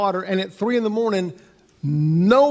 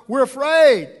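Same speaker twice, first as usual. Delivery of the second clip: higher, louder cry of mock alarm; afraid; 6 semitones higher, 6 dB louder, slower than usual. Disciples seeing a ghost on the water, acted-out alarm. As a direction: act out frightened men raising their voices. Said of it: "now they're afraid".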